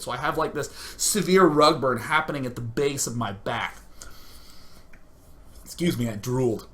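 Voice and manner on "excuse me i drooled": usual speaking voice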